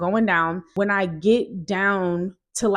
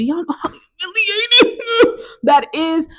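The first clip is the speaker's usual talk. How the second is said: high, loud laughing cry; real embarrassed laugh, shouted; 11 semitones higher, 7 dB louder than usual. Real, not acted. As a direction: shriek it out through laughter. Laughing at how humiliating it was.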